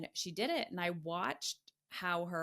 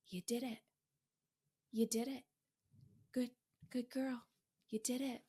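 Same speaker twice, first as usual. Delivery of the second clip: soft, higher, gentle praise to her inner self; tender self-praise; 6 semitones higher, 3 dB quieter than usual. Retold or imitated. Praising herself after the breakthrough, tenderly. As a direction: soothe yourself like a proud parent.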